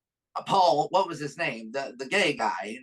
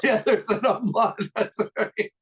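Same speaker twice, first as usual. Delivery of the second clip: laughing; 6 semitones higher, 4 dB louder than usual. Real, not acted. Joking about a name's spelling.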